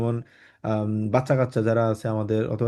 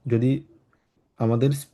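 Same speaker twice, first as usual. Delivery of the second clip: usual speaking voice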